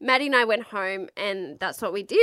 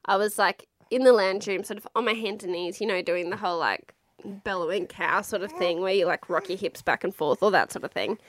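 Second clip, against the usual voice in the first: ordinary talk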